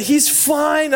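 high, tearful reassurance imitated; mock crying. Crying wife giving husband the news, high mock sob. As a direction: pretend to sob out good news. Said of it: "and she's crying".

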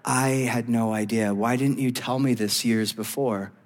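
low, soft, hurt disbelief; reproche blessé. Acted. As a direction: quietly confront a partner who kept a secret. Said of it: "and you're like".

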